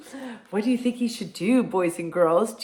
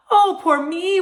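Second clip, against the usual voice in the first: high, loud mock wail in an Irish accent; lazy man hamming up his misery; 10 semitones higher, 6 dB louder than usual. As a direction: wail it, dramatically self-pitying. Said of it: "wailed Jamie".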